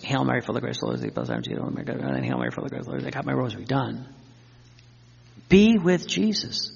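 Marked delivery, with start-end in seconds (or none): 0.0-4.1 mock hurried rosary, words tumbling together; rushed rote prayer. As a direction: gabble the prayer just to get it done. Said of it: "don't just".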